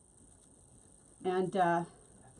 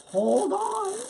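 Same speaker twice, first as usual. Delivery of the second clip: louder hold on; 10 semitones higher, 7 dB louder than usual. Real, not acted. Crafter halts her own plan on stream, loud and high. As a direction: hold ON called out higher and louder.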